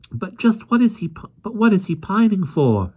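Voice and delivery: put-on high childlike girl's voice; a curious girl asking eagerly; little girl Jane. A narrator's reading. Jane asks the policeman, high girlish voice. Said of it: "asked Jane".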